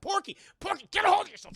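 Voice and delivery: high, urgent cartoon scolding; Roadrunner. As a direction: snap a panicker out of it. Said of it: "Roadrunner starts talking to Porky Pig".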